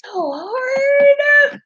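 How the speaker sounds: stretched high wail on "hard"; drawn-out wail. Real, not acted. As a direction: let "hard" soar up and hang. Playful complaint that it is hard.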